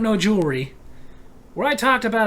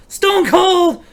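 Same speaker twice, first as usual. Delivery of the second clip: excited; 9 semitones higher, 9 dB louder than usual. Acted. Commenter excited about rumor.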